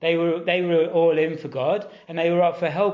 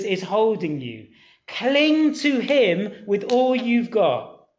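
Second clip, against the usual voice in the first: slow, raised pleading; fervent exhortation; 5 semitones higher, slower than usual. Acted. Preacher urging the congregation to cling to Jesus, fervent. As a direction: press the point slowly and fervently.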